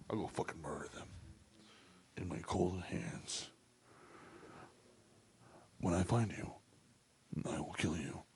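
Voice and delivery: very low, hushed menacing threat; quiet, deadly impersonation; movie tough-guy impression. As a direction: drop low and soft, with long deliberate pauses. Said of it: "Did that sound like him?".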